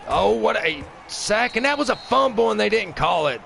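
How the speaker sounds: furious shout at the missed call; outraged shout. Real, not acted. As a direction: yell at the refs.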